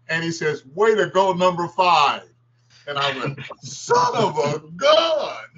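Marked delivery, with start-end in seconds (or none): 0.7-2.3 louder, higher praise call; cheering referee. Retold or imitated. Referee praises him on floor. 3.6-5.4 slow, much higher astonished shout; amazed exclamation. Retold or imitated. His amazed reaction to referee.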